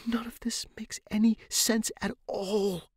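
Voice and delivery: high, breathless whisper; frightened whisper. Acted. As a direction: whisper it in disbelief. Terrified girl whispering in dark room.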